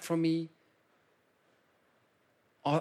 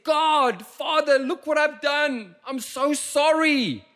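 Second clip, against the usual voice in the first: much higher, louder pleading cry; repentant cry; 8 dB louder, slower than usual. Acted. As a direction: cry out to God like a guilty child. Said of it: "they said".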